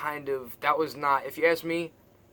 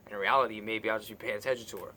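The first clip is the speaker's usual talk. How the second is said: normal talking voice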